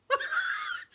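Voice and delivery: very high squealed laugh; imitated laugh burst. Me unable to stop at the funeral, squealing laughter. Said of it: "like that".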